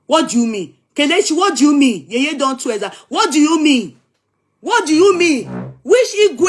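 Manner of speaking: playfully furious at the commenters questioning his king; mock angry demand. Teasing a commenter with a mock-angry demand. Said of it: "That's how you people will bring out the bad part of me".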